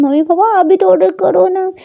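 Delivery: coaxing daughter. Acted.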